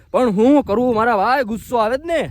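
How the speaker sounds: ordinary delivery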